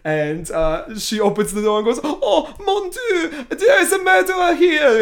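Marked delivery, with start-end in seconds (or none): 2.0-5.0 high, loud, hammed-up French accent; Yvette, awful French accent. Imitating a maid who found trouble, hammed-up French accent. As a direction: wail it in a terrible French accent. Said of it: "in this awful French accent".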